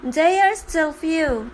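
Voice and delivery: ordinary talk, nothing marked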